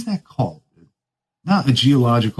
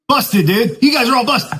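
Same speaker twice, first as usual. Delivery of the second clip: higher, teasing call of busted; playful higher-pitched accusation; mock accusation; 11 semitones higher than usual. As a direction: catch them out, jokingly. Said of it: "I was like".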